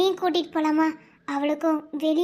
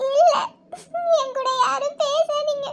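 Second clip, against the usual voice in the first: high weeping, pushing them away; crying refusal; tearful refusal; 9 semitones higher, 3 dB louder, slower than usual. Acted. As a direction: sob stubbornly, begging to be left alone. Sister crying, sending everyone away.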